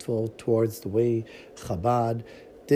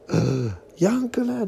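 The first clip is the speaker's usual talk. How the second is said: overjoyed cry; 7 semitones higher, much slower than usual. Acted. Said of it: "the father cries".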